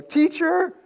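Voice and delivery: high mock yell of Teacher; retold yell. Disciples crying out to Jesus in the storm, high mock yell. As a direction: call out Teacher like a frightened disciple. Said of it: "when they're yelling".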